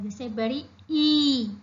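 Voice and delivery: बड़ी ई with the ई held long; long ee sound. Shown as a demonstration. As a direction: stretch the long ई out. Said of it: "ज्यादा वक्त लेता है". (बड़ी ई को लंबा खींचकर बोलना)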